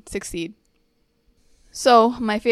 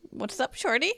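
imitating a Toronto guy's greeting through a laugh; mocking the new slang on a FaceTime call; a light Toronto accent, laughing; 4 semitones higher, 4 dB quieter than usual. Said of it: "how you actually talk".

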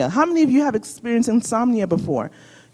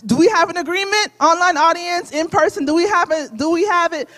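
rousing call-out; 8 semitones higher than usual. Real, not acted. Calling out to the congregation.

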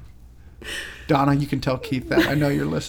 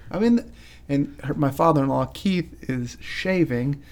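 ordinary delivery

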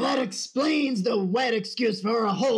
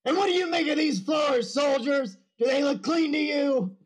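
barking drill sergeant; 4 semitones higher than usual. Acted. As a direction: bark the question at the troops. Sergeant inspecting floors.

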